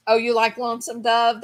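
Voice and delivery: ordinary delivery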